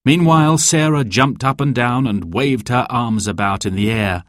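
plain reading voice

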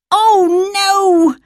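high, loud dismayed cry; exclaiming. Acted. Dan covered in jam, high loud dismayed cry. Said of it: "he exclaimed".

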